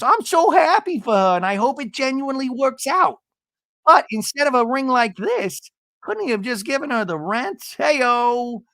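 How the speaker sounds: high, hammed-up housewife voice; Gina. Imitating a housewife congratulating then jabbing about rent, high mock voice. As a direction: pitch it way up in a mocking impression. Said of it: "horrible imitation I do of you".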